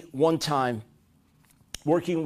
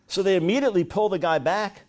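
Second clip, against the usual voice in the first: ordinary delivery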